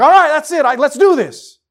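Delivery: loud, high, pumped-up player; roused and raring to play; fired up. Acted. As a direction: psych yourself up to go. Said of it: "you get fired up".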